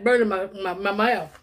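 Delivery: normal talking voice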